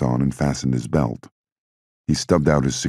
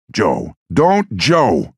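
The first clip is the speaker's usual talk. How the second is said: loud yell, higher pitch; 8 semitones higher, 5 dB louder, much slower than usual. A narrator's reading. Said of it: "Giuseppe yelled".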